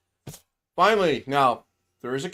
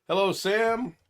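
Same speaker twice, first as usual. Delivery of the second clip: ordinary talk, nothing marked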